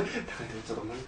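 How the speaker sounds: timid whisper. Retold or imitated. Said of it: "切符売り場のとこで".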